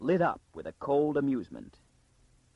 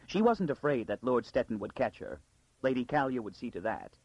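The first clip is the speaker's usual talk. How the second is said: usual speaking voice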